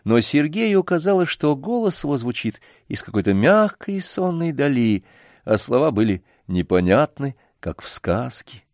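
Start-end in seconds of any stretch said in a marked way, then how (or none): none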